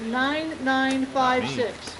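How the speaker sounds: drawn-out raffle number call; slow number call. Real, not acted. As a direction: read each digit out very slowly.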